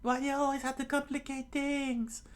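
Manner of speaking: very high falsetto complaint; exasperated Nami. Acted. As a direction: scold in a put-on girl's voice. Nami scolds Luffy.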